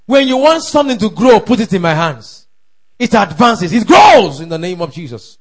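loud, driving declarations with shouted peaks; forceful pulpit shouting; full-force preaching. Real, not acted. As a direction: proclaim it to the congregation at full voice.

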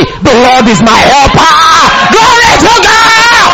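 slow declaration building to a high shout; bold response. Shown as a demonstration. Preacher shows believers how to respond to God, boldly shouting. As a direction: proclaim it, then shout the praise. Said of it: "That's how to respond".

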